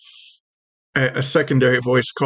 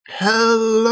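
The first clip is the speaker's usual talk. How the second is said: long, drawn-out high greeting; high critter voice, vowels stretched; tiny creature calling out; 9 semitones higher than usual. Acted. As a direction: call out slowly in a small, high voice. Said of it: "the first one calls out".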